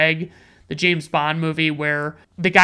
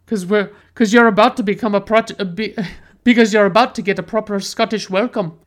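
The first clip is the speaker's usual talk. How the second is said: put-on thick Scottish accent, raised and gruff; broad Scottish accent; 3 semitones higher, 3 dB louder than usual. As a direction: imitate a heavy Scottish tough guy, slightly higher. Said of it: "super thick Scottish accent says".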